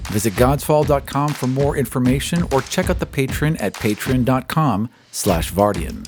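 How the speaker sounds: usual speaking voice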